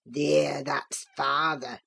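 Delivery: very low, gloating dialect voice; croaking old man. A narrator's reading. Old servant gloating over the boy's fury, croaking. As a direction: crow it slowly in a deep old man's croak. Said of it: "Joseph uttered his croaking laugh again".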